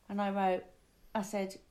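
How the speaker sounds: ordinary delivery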